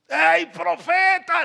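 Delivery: high, drawn-out mock call; llamado irreverente fingido. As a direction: holler the wrong title, stretched out. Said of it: "¿Cómo le dijo Eliseo a Elías?". (llamado burlón, agudo y alargado)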